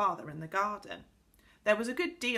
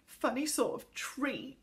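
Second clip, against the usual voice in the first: put-on slow, higher, put-out child; sulky sniffy grumble; 6 semitones higher than usual. A narrator's reading. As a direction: grumble as if near tears. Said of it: "Connie sniffed".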